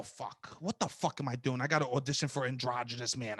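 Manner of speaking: low, hushed retold grumble; muttering to himself. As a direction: mutter it under your breath, low and soft.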